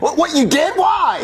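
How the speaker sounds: high, startled questioning; shocked disbelief. Acted. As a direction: blurt it out, voice jumping up. Father shocked by a confession.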